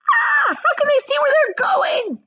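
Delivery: very high, loud frightened shriek; Violet shrieks. A narrator's reading. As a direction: shriek it in the dark. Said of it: "shrieked Violet Beauregarde".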